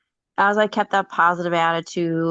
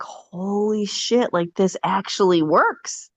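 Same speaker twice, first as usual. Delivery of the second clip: slow, awed, a touch hushed; realizing the magic truly works; amazed; 2 semitones higher, slower than usual. Retold or imitated. Recalling the car gift, slow amazed awe. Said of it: "I was just like".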